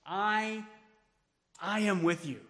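I, I am with you, repeated I stressed. Shown as a demonstration. Pastor showing Jesus repeats I, emphatic. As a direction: hit the doubled I. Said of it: "He uses the word "I" twice".

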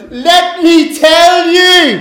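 high, slow, half-shouted; loud insistence. Retold or imitated. Peter forcing the crowd to believe him, a slow high shout. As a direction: bellow to be believed. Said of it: "And he got louder and louder".

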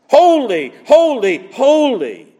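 holy called out loud, each one falling; ringing proclamation. Acted. Preacher crying out the angels' holy, holy, holy. Seraphim cry retold.

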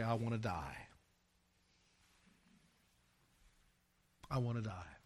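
quiet surrender. Acted. Preacher voicing the sanctified believer's surrender, hushed. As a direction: let it sink to a hush.

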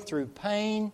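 ordinary talk

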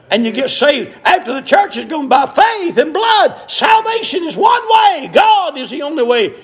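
rising pitch, building to a high pulpit shout; fervent, escalating sermon cadence; preaching build. Real, not acted. Preaching builds to full force.

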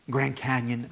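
ordinary delivery

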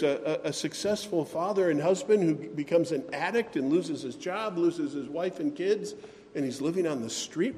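ordinary delivery

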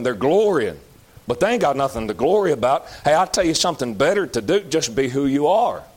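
ordinary talk

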